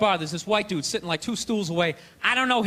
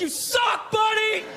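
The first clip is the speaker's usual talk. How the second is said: put-on drunk heckler; 8 semitones higher, slower than usual. Drunk fan in the stands, jeering. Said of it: "you're like".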